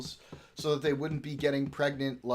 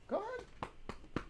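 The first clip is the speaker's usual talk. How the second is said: slow, high whisper to the dog; slow whispered encouragement; whispered high coax; 8 dB quieter, much slower than usual. Real, not acted. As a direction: breathe it out softly to the pup.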